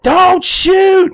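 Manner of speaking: high, loud yell. Retold or imitated. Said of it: "he's like".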